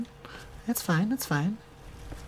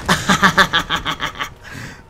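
loud laugh; 7 dB louder than usual. Real, not acted. Laughing after the escape.

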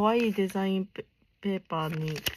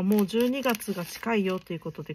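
usual speaking voice

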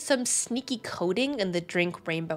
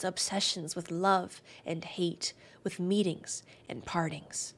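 poetic reading; 4 dB quieter than usual. Acted. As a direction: read it gently, lingering on the phrases. Reads the weapon story aloud.

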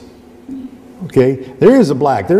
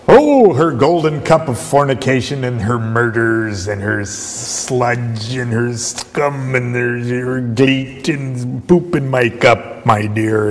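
theatrical, slow, lurid relish; Crowley; slower than usual. Acted. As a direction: draw out each foul word in a mock-grand voice. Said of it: "when you hear Crowley talking about".

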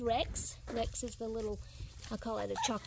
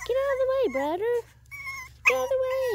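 much higher and louder puppy voice; voicing the puppy; 13 semitones higher, 9 dB louder, much faster than usual. Owner speaking for the puppy pushing its brother, loud and high. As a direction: shout it for the pup, high and loud.

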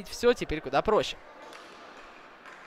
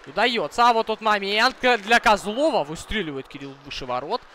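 high, loud rising commentary rush; excited play-by-play; 8 semitones higher, 8 dB louder than usual. Real, not acted. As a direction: call the scramble at the net, shouting. Live hockey attack near goal.

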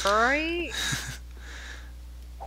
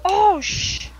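high cut-off yelp of alarm; startled half-curse, loud; panicked cry; 12 semitones higher, 6 dB louder than usual. Real, not acted. Zombie attacks in game.

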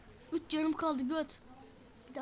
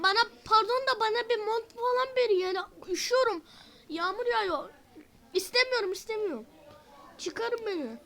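high, loud play-acted protest; loud pleading complaint; 6 semitones higher, 6 dB louder than usual. Prisoner begging guards for a coat in rain, loud complaint. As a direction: wail to be let out of the rain.

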